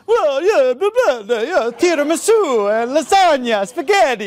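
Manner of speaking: hammed-up Italian accent, high and loud; mock Italian. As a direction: play a loud, exaggerated Italian rattling off food names. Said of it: "you could do these kind of impressions".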